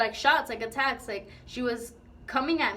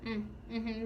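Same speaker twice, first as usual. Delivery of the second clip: short with him; 10 dB quieter than usual. Retold or imitated. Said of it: "like, being short with you".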